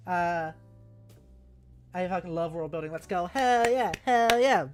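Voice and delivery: ordinary talk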